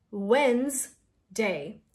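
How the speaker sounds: Wednesday very slowly, "wenz... day"; Wednesday stretched as "WENZ, day"; broken-down pronunciation. Shown as a demonstration. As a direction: split Wednesday into two syllables, no D. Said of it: "Forget about the D".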